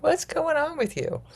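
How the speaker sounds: high-pitched mock questioning; acted-out incredulous reply; imitating alarmed loved ones. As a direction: pitch it way up, as startled listeners would. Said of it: "They'll go like".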